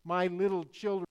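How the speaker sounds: ordinary delivery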